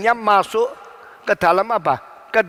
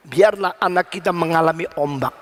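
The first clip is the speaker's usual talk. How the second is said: usual speaking voice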